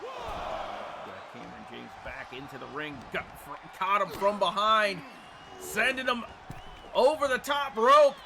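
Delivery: loud, high excited play-by-play; wrestling commentator. Acted. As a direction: call the big moves like a ringside announcer.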